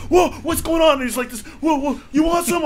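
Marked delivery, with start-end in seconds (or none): 0.1-0.9 much higher yelled "whoa"; hammed-up jumpy coworker; mock startled yell. Imitating coworker, startled bravado. 1.6-2.6 much higher yelled taunt; hammed-up jittery tough guy; mock tough challenge.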